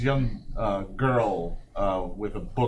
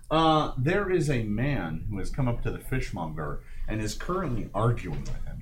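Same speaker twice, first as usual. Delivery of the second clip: normal talking voice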